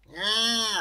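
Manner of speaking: high, loud, drawn-out creature voice; high-pitched Kelpie; Kelpie character voice. A narrator's reading. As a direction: voice a put-upon water horse, pitched high. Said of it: "said the Kelpie".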